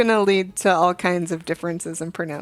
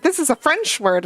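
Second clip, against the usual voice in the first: higher-pitched mock insistence; lightly imitated fussy teacher; 8 semitones higher than usual. Teacher making a big deal at the board, lightly imitated insistence. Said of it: "made a whole big deal out about it".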